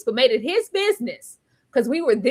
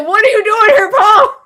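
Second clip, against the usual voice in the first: higher and louder indignant yell; mad outburst; 8 semitones higher, 10 dB louder than usual. Retold or imitated. Fan yelling at the screen at Paul, angry. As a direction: demand it like you're outraged. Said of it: "this made me so mad".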